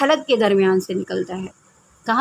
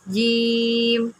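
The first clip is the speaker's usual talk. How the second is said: جیم stretched out very slowly; جیم with a long held ee, three alifs long; long drawn vowel; much slower than usual. Shown as a demonstration. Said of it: "تین الف کے برابر لمبا".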